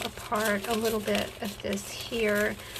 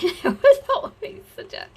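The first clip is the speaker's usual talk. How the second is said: much higher, louder laugh through words; 13 semitones higher, 7 dB louder than usual. Real, not acted. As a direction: laugh out the memory. Laughing at the memory.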